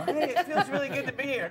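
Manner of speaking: high, falsely delighted greeting; fake stage cheer. Comic faking delight to a crowd, phony cheer. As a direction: put on a phony glad-to-be-here lift. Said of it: "perpetuating some lie".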